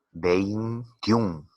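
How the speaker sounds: "Veintiún" slowly in two beats, vein-tiún; syllable demonstration. Shown as a demonstration. Teacher asking pupils to pronounce "Veintiún", syllable by syllable. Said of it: "Pronúncialo".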